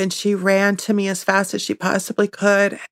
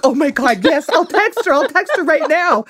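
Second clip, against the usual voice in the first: high-pitched reenacted excitement; frantic eagerness; 8 semitones higher than usual. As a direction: agree in an eager fluster, voice pitched up. Retelling her eager reply to husband.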